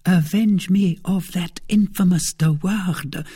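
high, tense, venomous lady's voice; between her teeth. A narrator's reading. Lady demanding vengeance from suitor, tight and high between her teeth. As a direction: demand revenge through clenched teeth. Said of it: "said Milady between her teeth".

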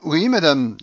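put-on De Griers flattering the old lady; fawning Frenchman. A narrator's reading. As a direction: answer quickly and obsequiously. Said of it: "dit aussitôt De Griers".

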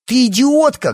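very high, loud outraged yell; shocked, furious cry; angry shout. A narrator's reading. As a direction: hurl the insult at full voice. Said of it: "крикнула Алиса Лии".

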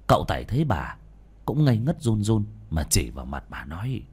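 ordinary delivery

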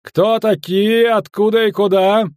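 loud, high military challenge; slow, ringing sentry's call; shouted challenge. Acted. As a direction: demand that strangers identify themselves. Commander challenging strangers on the road.

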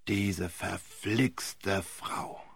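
put-on irritated old barrister; grumbling curse. A narrator's reading. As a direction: drag it out in disgust. Wilfrid cursing the wife.

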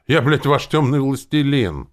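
loud, high, indignant assertion; indignant protest. A narrator's reading. Hero reminding zombie who is dark lord, indignant. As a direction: assert your rank loudly.

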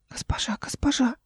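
put-on high, hushed child's call; small whispered call for attention; thin little whisper. A narrator's reading. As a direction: whisper urgently in a thin boyish voice. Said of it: "распознала как тонкий голосок".

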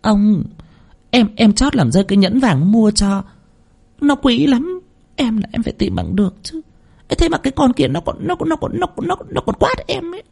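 simper and whine like a spoiled wife; whiny wheedling. A narrator's reading. Second wife clings to her husband, whiny babyish pleading. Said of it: "mà nũng nịu".

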